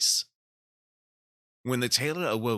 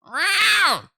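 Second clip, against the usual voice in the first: very high, loud meow; cat meowing; 11 dB louder than usual. A narrator's reading. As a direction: yowl like an impatient cat at the door. Simpkin at window.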